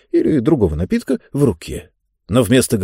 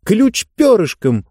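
higher, excited cry of discovery; exclaiming; 9 semitones higher than usual. A narrator's reading. Girl exclaiming as she guesses the answer, excited. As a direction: blurt it out as the idea hits. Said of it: "воскликнула Диба".

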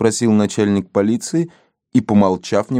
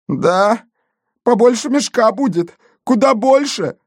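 high, excitable insistence; emphatic answer; 11 semitones higher than usual. A narrator's reading. Gendarme answering chief about the hole, excitedly emphatic. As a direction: answer high, topping it with each phrase.

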